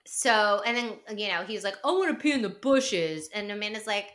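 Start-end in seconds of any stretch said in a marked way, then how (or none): 1.8-3.3 higher, dopey put-on bro voice; mock drunk bro. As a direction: play it like a silly drunk guy. Kyle vs Amanda mocked.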